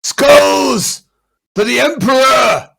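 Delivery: high, full-force war cry; battle shout. Acted. Pit fighter lifting skull and spine, shouting a war cry. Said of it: "I'm gonna shout".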